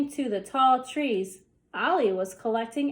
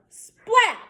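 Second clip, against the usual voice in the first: high, loud splat; exclaimed sound effect; 10 semitones higher, 7 dB louder than usual. A narrator's reading. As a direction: pop the sound word out. Sound word in story.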